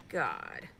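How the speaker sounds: low pained groan, slow. Real, not acted. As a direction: moan through the pain. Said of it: "everything hurts".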